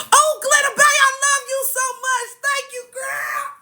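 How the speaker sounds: very high-pitched gushing squeal; overjoyed squeal. Real, not acted. Host thanking Glitter Bay for a gift, ecstatic squeal. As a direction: squeal your thanks, stretching the last word.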